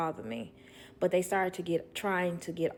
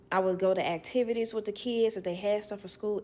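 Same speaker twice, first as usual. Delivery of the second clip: ordinary delivery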